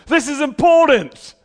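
loud and high, every word a shout; an overexcited letter read aloud; exclamation-mark emphasis. Shown as a demonstration. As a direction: read it like it has twenty exclamation marks. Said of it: "I put, like, twenty exclamations".

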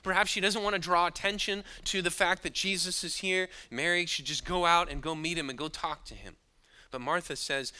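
ordinary talk

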